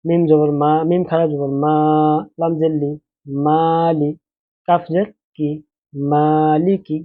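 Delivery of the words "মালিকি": মালিকি read slowly, মা held one alif long, লি কি quick; slow drawn-out reading. Shown as a demonstration. Teacher shows students how to read মালিকি, slow and drawn out. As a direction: stretch the মা in মালিকি, clip লি and কি. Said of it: "মাটা এক আলিফ টানতে হবে".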